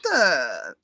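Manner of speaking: corny. Acted. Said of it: "he's so corny".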